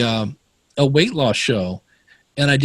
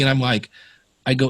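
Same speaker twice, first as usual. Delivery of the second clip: ordinary delivery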